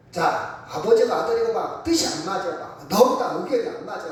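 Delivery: usual speaking voice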